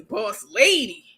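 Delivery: ordinary talk, nothing marked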